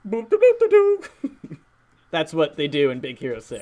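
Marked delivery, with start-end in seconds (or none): 0.1-1.6 high, loud nonsense sound effect; bouncy, high-pitched mouth sound effect; imitated fist-bump noise. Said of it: "I'm just gonna go".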